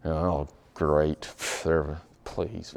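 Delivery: a reluctant sigh of a handover; grudging. Retold or imitated. Congregant grudgingly handing over the offering, low sour sigh. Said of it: "That's grudgingly".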